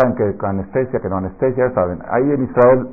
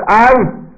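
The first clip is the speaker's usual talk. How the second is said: short high cry of ouch; mock yelp of pain; 12 semitones higher, 6 dB louder than usual. Rabino imita el grito de alguien picado, agudo. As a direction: yelp as if stung. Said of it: "Y si te pican y dices".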